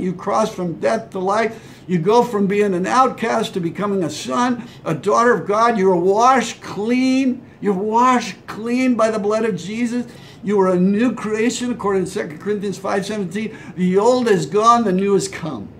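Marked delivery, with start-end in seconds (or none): 5.8-10.1 slow emphasis. Real, not acted. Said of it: "preacher's emphatic slow line".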